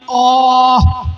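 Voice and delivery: a long, slow aah of labour pain; drawn-out groan of pain. Acted. Preacher voicing a mother's first cry of pain, drawn out. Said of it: "جو اس نے درد میں پہلی آواز نکالی".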